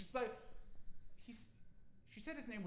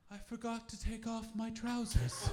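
dazed posh woman; 7 semitones higher, 4 dB louder than usual. Retold or imitated. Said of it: "she went".

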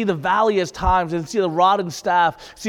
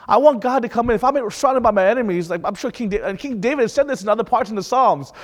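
normal talking voice

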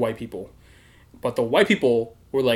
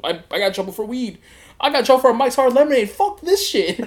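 faster, climbing higher and louder into laughter; rising panic; 10 semitones higher, 3 dB louder than usual. Retold or imitated. To himself among murderers, panicking, fast and climbing high. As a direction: build from nervous to a laughing outburst.

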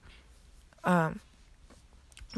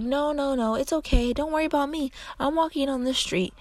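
a hint of a carefree grandma; 7 semitones higher than usual. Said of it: "She's like".

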